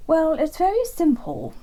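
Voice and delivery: a hint of an eager old hare; Hare's voice. Hare voiced in bedtime story.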